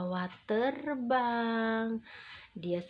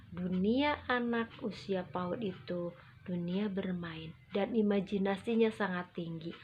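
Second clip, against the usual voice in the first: usual speaking voice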